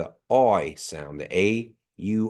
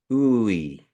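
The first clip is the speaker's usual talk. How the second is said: elongated vowel; 2 semitones higher, 4 dB louder than usual. Shown as a demonstration. Teacher shows students how a colon lengthens ooey. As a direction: draw out ooey longer, as with a colon. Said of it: "You're elongating that sound".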